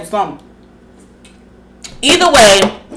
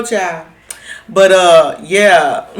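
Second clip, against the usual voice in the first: ordinary talk, nothing marked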